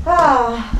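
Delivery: ordinary talk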